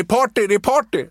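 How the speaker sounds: high, insistent mock yelling; shouting mascot. Mascot still calling after fleeing Erik, high insistent yell. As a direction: keep yelling it after him. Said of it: "ropar fortfarande".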